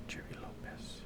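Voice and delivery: a breathy whisper, half to himself, trying out the name; hushed whisper of a half-remembered name; whispered to self. Real, not acted. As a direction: whisper the name as if testing your memory. Muttering to himself while recalling a name.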